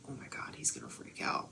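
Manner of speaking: hushed, breathless whisper to herself; whispered panic. Retold or imitated. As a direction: whisper it so he can't hear.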